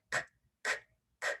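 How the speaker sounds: "k" as a breathy click with no voice; unvoiced k. Shown as a demonstration. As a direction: release "k" with air only. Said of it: "no voice at all".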